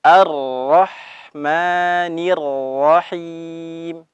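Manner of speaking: slow Ar-Rahimi with vowel ending; Quranic recitation. Shown as a demonstration. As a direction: stretch out Ar-Rahimi gently. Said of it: "Kita ulang". (bacaan pelan Ar-Rahimi)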